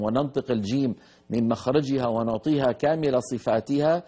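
ordinary delivery

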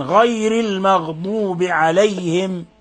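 غير المغضوب with full, emphatic gh sounds; heavy ghayn. Shown as a demonstration. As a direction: slowly model a thickened gh in غير المغضوب. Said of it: "فخم الغين".

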